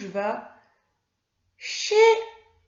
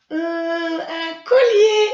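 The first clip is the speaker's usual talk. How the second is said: put-on much higher, louder, slow child puppet voice; Mimi; 8 semitones higher, 13 dB louder, much slower than usual. Mimi the puppet answering her teacher, high child voice. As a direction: answer slowly like an eager little puppet.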